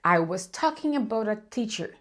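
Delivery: "about a teacher" run together with light schwas; unstressed "a" as a soft uh in "about a teacher"; reduced linked speech. Shown as a demonstration. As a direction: link "about a teacher" smoothly, stressing BOUT and TEA. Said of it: "ditas de forma reduzida".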